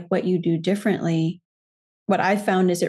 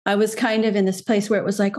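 normal talking voice

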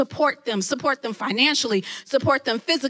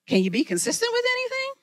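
taunting inner voice; 11 semitones higher, faster than usual. Retold or imitated. Voice of her past taunting.

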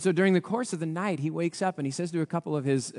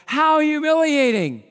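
mock pity; 11 semitones higher, 8 dB louder, slower than usual. Real, not acted. Preacher mocks Haman's fate.

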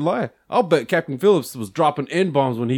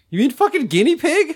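indignant retort; 13 semitones higher, 7 dB louder than usual. Retold or imitated.

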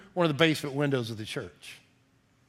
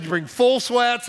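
coach's order; 7 semitones higher, 4 dB louder, slower than usual. Retold or imitated. Coach giving orders to team.

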